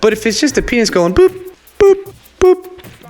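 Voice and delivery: high boop noises; beeping sound. Pretend. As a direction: chirp it like a scanner. Imitating beeping device.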